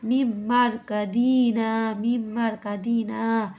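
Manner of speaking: মিম মার কাদিনা slow, the মিম held and merged; recited with idgham. Shown as a demonstration. Teacher reciting মিম মার কাদিনা slowly for students. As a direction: blend and hold the doubled মিম in মিম মার কাদিনা. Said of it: "ইদগাম হবে".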